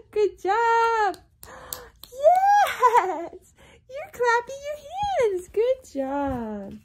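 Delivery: high, loud cheering; excited praise. Real, not acted. Praising baby loudly.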